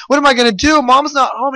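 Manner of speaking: lightly voiced child in a panic; panicking boy. A narrator's reading. Boy panics as mayor pounds door.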